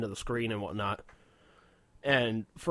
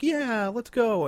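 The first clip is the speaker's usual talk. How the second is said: mock girlfriend voice, much higher and slow; a drawn-out, high imitation of her eager yes; 11 semitones higher, 4 dB louder, much slower than usual. Girlfriend voiced in retold story.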